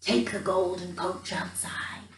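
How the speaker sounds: soft, breathy secret whisper. Acted. Royal dreams telling Gerda the way, soft whisper. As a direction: hush it like drifting dreams. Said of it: "they whispered".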